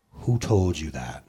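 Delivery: low, slow, measured question; signature challenge. Acted. Preacher gives his regular line from the pulpit, slow challenge. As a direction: drop low and draw out the challenge. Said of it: "What does A J always say".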